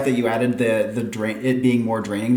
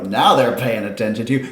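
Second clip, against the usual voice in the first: normal talking voice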